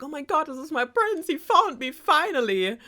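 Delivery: high-pitched, thrilled reunion cry; excited storybook princess. Acted. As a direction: gush it as an overjoyed princess, playfully hammed-up.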